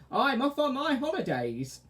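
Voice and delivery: high, loud witch. Acted. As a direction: crow it like a witch.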